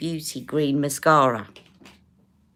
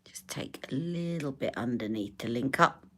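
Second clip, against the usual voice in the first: whispering; 2 semitones lower, 10 dB quieter than usual. Real, not acted. Whispering while applying makeup.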